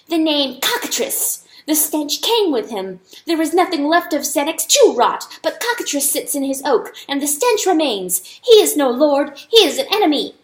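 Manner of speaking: name the enemy with building anger; accusing mouse. Acted. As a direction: mouse naming Cockatrice before the animals.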